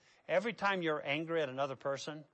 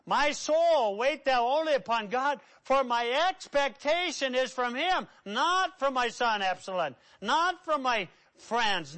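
raised pitch, punched key words; impassioned scripture preaching; 6 semitones higher, 6 dB louder than usual. Acted. Preacher voices David waiting on God, impassioned declaiming. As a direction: declaim the psalm, easing down toward the end.

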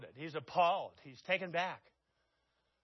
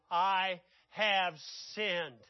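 slow confession; much slower than usual. Acted.

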